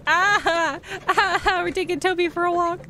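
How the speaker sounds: high, fast, thrilled squeal; excited squeal. Real, not acted. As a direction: burst out in giddy delight. Thrilled to walk the dog.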